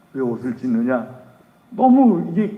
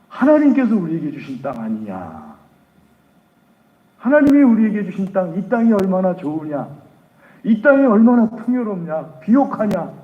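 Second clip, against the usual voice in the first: angry protest; 6 semitones higher, 5 dB louder than usual. Acted. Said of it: "너무 화가 나가지고".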